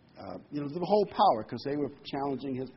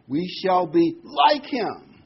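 "like" slow, higher and louder; emphasize "like" with a slow, lifted, louder push; stress on like; 5 semitones higher, 8 dB louder, slower than usual. Shown as a demonstration. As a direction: lean hard on "like" in "we shall be like him". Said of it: "We emphasize we're like him".

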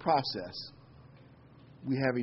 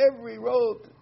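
high, lightly louder mockery; mocking quote; 11 semitones higher, 4 dB louder than usual. Preacher parodying popular religion's slogan, high and mocking. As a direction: parody their glib slogan. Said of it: "they say".

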